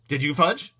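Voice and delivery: high, loud, impatient demand; not so calmly. Acted. Brother losing his cool with Fudge, sharp and loud. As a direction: press him, losing patience. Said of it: "not so calmly".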